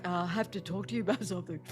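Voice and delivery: low, hushed, reluctant mumble; voice going down. Acted. Person with something to talk about, low deflated voice. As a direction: drop right down in volume and key. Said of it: "If you feel your voice going down in volume".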